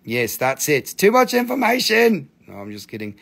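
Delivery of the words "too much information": high, loud fake outrage; hammed-up prudish cry; mock scandalised. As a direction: play at being shocked. Joking shock, then "just kidding.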